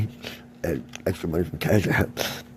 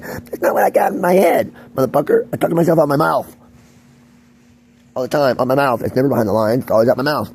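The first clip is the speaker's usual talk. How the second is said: loud, raised-pitch angry rebuttal; angry outburst; 5 semitones higher, 7 dB louder than usual. Real, not acted. Speaker correcting people who assume he hears voices, angry and loud. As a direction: hurl it out as a furious correction.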